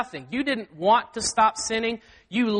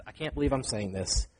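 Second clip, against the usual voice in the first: lower, softer imitated aside; out-of-his-mind aside; 8 semitones lower, 6 dB quieter than usual. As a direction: drop low and soft, as if to yourself. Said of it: "goes out of his mind for a second".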